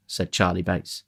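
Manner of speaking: ordinary delivery, nothing marked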